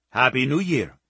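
put-on heavy Indian accent, cheerful greeting; a cabbie's warm Indian accent. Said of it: "in a heavy Indian accent".

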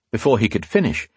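ordinary delivery, nothing marked

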